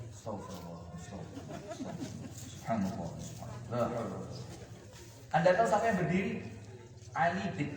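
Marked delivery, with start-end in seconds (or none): 0.1-4.2 very low, barely audible mumbling; muttered dhikr. Acted. Companions dodging the challenge, muttering prayers very low. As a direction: mumble it almost under the breath. Companions pretending not to hear.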